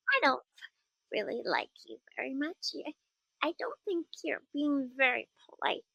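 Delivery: put-on high, prim little kid. Shown as a demonstration.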